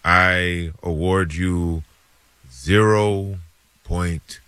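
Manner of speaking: slow, deliberate verdict with long pauses; movie-judge rendition. Acted. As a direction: pronounce judgment word by heavy word. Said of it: "It's a good rendition".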